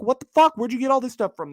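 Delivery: sudden high shocked cry; shocked outburst. Retold or imitated. Customer at checkout sees the price, shocked outburst. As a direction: blurt it out in disbelief. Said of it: "You're like".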